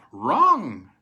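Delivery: a loud, punchy "Wrong!"; loud denial. A narrator's reading. Agent to doubting buyer, loud triumphant denial. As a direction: bark it out triumphantly.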